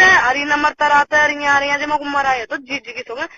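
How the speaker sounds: ordinary delivery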